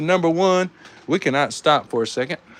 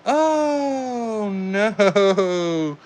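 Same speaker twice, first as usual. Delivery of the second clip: higher dismayed "Oh, no"; 5 semitones higher than usual. Real, not acted. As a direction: cry out in sudden dismay. His car loses the round.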